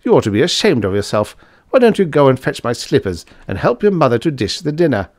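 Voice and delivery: lightly voiced irritable scolder; brisk, indignant telling-off; scolding man. A narrator's reading. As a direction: scold her and send her on errands.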